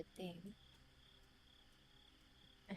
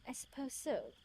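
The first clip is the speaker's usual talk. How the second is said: put-on British accent, higher pitched; 5 semitones higher than usual. Lover conceding to her offer, British accent. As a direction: answer in a proper British accent. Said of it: "Why is he British?".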